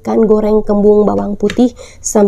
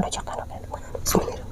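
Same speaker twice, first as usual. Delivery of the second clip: whispering; 14 dB quieter, much faster than usual. Real, not acted. Fast whispered prayer before eating.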